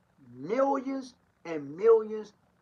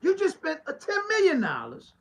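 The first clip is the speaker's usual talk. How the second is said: higher and louder, incredulous; heated preaching; 8 semitones higher, 8 dB louder than usual. Acted. Pastor calling out politicians who want votes, higher and louder. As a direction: let the outrage climb.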